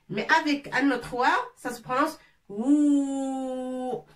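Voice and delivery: ou drawn out long and slow. Shown as a demonstration. Teacher stretching the long ou for students. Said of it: "C'est plus long".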